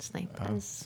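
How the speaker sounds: put-on low, soft New England accent on Snipers. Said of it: "He was New England accent".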